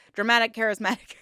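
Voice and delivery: quick playful attempt at a tricky phrase; tongue-twister fast. Shown as a demonstration. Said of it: "Say that five times fast".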